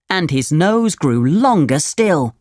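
usual speaking voice

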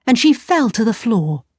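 ordinary delivery, nothing marked

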